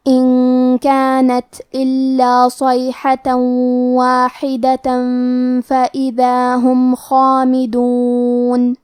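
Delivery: slow melodic tajweed recitation; Quran recitation. Acted. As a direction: recite slowly, each letter drawn out. (دھیمی قراءت تجوید کے ساتھ)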